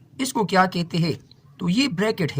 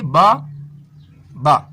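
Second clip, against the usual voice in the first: "बा, बा" as two separate beats, long pause between; split into syllables; 4 semitones lower, 6 dB louder, much slower than usual. Shown as a demonstration. Teacher showing Urdu syllable division, split into syllables. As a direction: break "बा, बा" apart, each syllable standing alone. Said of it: "इसमें एक रुकन बा है".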